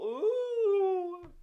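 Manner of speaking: high loud mocking ooh; jeering crowd ooh. A narrator's reading. The children jeering at Jimmy, high mocking ooh. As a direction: jeer in disbelief.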